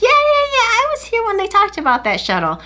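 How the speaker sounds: put-on perky store girl.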